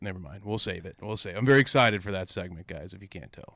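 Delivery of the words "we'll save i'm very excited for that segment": normal talking voice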